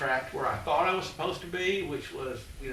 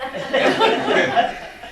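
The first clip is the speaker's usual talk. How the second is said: breathy, loud burst of laughter; 13 semitones higher, 12 dB louder than usual. Real, not acted. Storyteller laughing at his interview story, loud laugh. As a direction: laugh out hard at the punchline.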